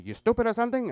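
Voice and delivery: a loud mock scolding from grandfather; mock grandfather yell. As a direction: bark it at the kid like an annoyed grandpa.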